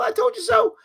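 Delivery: high, singsong gloating taunt; teasing told-you-so; little I told you so. Pretend. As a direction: rub it in like a smug kid. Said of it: "I do a little".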